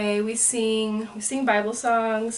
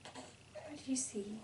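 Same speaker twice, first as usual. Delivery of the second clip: whispering; 5 semitones higher, 11 dB quieter than usual. Real, not acted. Whispered question to baby.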